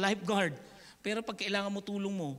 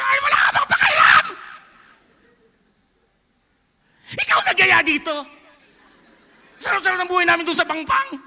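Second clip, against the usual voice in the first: high, loud exasperated shout; angry scolding; 8 semitones higher, 16 dB louder than usual. Retold or imitated. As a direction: scold him for sleeping. Disciples scolding sleeping Jesus. (galit na sermon sa natutulog)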